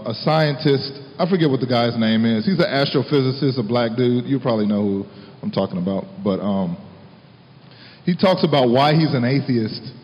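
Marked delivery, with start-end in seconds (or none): none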